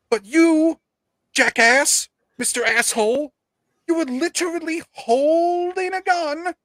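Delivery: high, incredulous scolding; exasperated rant. Pretend. Rant scolding Baldwin in absence.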